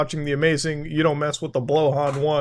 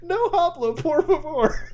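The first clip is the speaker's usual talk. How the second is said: high, panicky plea in broken Spanish; mock helpless foreigner; 5 semitones higher, much slower than usual. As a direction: play up a flustered tourist begging for help. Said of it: "We wanna be like".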